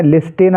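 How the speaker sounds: "लिस्टेन" with the t sounded; wrong "लिस्टेन", ending in -ten; wrongly voiced T. Shown as a demonstration. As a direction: misread "लिस्टेन" with a hard T. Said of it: "गलत हो जाएगा".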